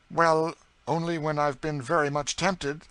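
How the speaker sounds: earnest New England aunt, a little indignant; Miss Ophelia. A narrator's reading. Prim Ophelia defends herself to her teasing cousin, earnest.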